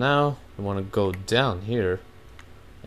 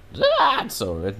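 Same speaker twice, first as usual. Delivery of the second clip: voice cracking; 5 semitones higher, 5 dB louder than usual. Real, not acted. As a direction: let the voice break as it climbs. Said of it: "man, my voice just cracked".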